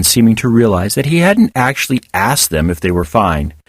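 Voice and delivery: usual speaking voice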